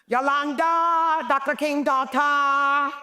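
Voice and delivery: high, drawn-out sing-song call; playful high sing-song greeting; calling out Yolanda. Retold or imitated. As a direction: call her name across the room, teasing. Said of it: "I'd go".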